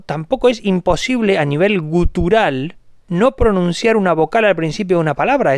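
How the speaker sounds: usual speaking voice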